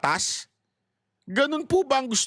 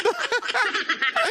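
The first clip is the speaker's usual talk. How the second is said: high-pitched amused laugh; 13 semitones higher than usual. Real, not acted. Vlogger laughing at the man behind the speaker, high laugh. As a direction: burst out laughing at the clip.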